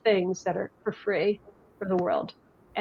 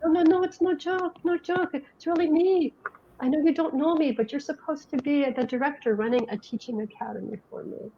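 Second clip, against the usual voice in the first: higher mock German accent, insisting; light German accent, pitched up and pleading; 6 semitones higher, 3 dB quieter than usual. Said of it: "this guy with a German accent".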